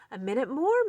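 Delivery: high, loud shout from the window. Acted. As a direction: call down to Mole waiting below. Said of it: "Mouse shouted".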